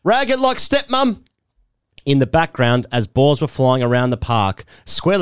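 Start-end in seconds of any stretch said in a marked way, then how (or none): 0.0-1.2 high, pitched-up mock yell across the field. Teammates yelling from the boundary, high mock yell. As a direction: call it out high like teammates heckling. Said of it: "The lads were yelling".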